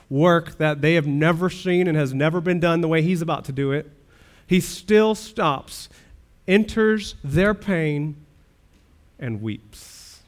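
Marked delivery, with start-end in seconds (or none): 4.5-10.2 slow emphatic. Acted. Preacher slowing on Jesus weeping.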